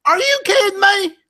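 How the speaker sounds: very high, outraged squeal of disbelief; hammed-up shock at the suggestion; mock disbelief.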